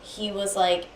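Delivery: ordinary delivery, nothing marked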